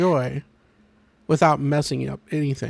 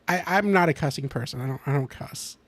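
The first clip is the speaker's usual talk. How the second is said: usual speaking voice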